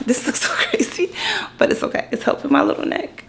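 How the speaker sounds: real whispered aside. Real, not acted. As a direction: whisper it, amused at yourself. Aside about her neck support.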